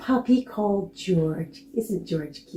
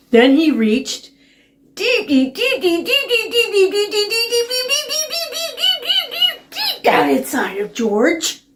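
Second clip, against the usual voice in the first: building suspense; 4 dB louder than usual. A narrator's reading.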